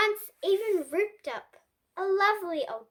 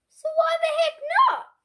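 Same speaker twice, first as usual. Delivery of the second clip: higher, louder and fast; cheeky gleeful; 11 semitones higher, 6 dB louder, faster than usual. A narrator's reading. Pig justifying his big list, cheeky. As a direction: toss it off with cheeky glee. Pig's greedy reasoning.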